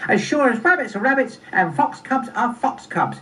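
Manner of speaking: high, emphatic cub voice; high-pitched, certain fox cub; third fox cub. Acted. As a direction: recite it like a little cub's catchphrase. Third cub repeats catchphrase.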